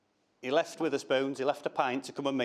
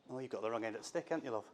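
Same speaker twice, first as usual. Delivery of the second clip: soothing; 5 semitones lower, 11 dB quieter, much faster than usual. Retold or imitated. Parents soothing daughter, retold.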